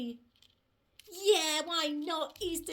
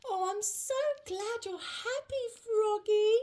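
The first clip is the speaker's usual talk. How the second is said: usual speaking voice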